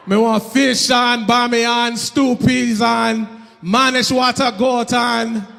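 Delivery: slow, loud, lilting patois food order; rhythmic Jamaican order. Retold or imitated. Comic finally orders in the Jamaican restaurant, slow lilting patois. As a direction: roll through the order with island rhythm. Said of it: "practice my rhythm".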